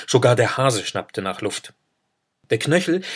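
plain reading voice

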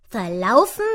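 loud, disbelieving squirrel question; brummiges Eichhörnchen. A narrator's reading. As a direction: ask it loudly with a rising end. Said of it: "brummte das Eichhörnchen". (laut und ungläubig brummend)

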